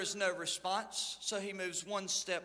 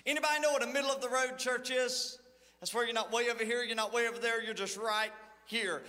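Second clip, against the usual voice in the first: normal talking voice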